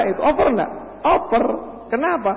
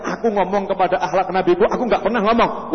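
ordinary talk, nothing marked

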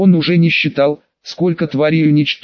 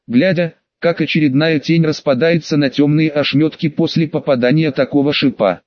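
ordinary delivery, nothing marked

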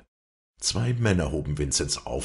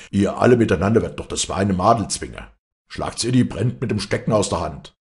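loud, raised, urgent order; fevered angry command; 5 semitones higher, 7 dB louder than usual. A narrator's reading. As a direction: goad the crowd, seething with anger. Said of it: "befahl er fiebernd vor Zorn".

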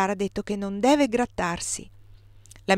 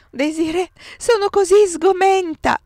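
high, loud cry of distress; dismayed outburst; 10 semitones higher, 9 dB louder than usual. A narrator's reading. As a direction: wail it to a sister in dismay. Overwhelmed sister confesses her dismay.

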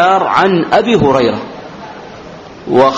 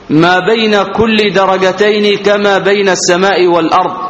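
higher, sustained reading tone; formal hadith recitation; 5 semitones higher than usual. Acted. Teacher reads the Prophet's words to students, formal recitation. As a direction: recite the text formally on a raised pitch.

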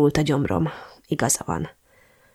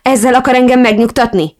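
indignant question, raised in pitch; affronted, higher-pitched challenge; disapproving; 6 semitones higher, 4 dB louder than usual. A narrator's reading. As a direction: throw it back reproachfully. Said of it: "Pillantottam rá rosszallóan".

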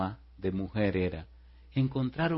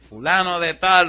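loud, raised announcing call; 7 semitones higher, 11 dB louder than usual. Retold or imitated. Monitor summons a student to the little lounge, calling out. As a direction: holler it to the whole dormitory.

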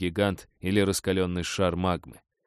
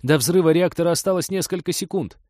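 high, loud frantic scream; panicked shout; 9 semitones higher, 6 dB louder than usual. Acted. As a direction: shriek the warning in panic. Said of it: "от волнения потеряв своё обычное самообладание".

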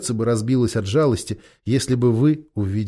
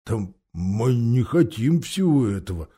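put-on old bear voice. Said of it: "сказал старый медведь".

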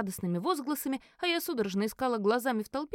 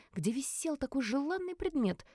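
usual speaking voice

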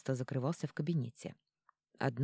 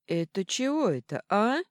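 stern dwarf woman, louder; 5 semitones higher, 6 dB louder than usual. Acted. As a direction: demand it sternly. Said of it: "строго спросила она".

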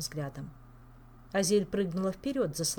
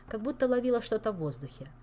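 ordinary delivery

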